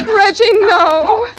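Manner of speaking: high, loud desperate scream of his name; terrified scream. Acted. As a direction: scream for your life as he strangles you. Woman being strangled screams.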